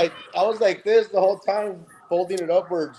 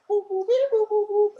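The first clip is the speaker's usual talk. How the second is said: high beeping mouth noise. Retold or imitated. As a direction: beep it out high like an alert. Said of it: "I was like".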